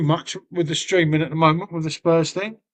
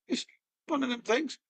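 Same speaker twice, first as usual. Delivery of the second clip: ordinary delivery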